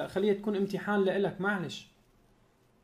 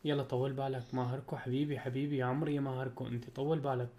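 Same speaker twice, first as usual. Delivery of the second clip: soothing; 6 semitones lower, 6 dB quieter than usual. Real, not acted. Friend consoling on phone.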